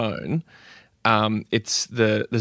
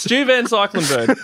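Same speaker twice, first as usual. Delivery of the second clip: laughing mock name; 11 semitones higher, 4 dB louder than usual. Real, not acted. Joking mangled name.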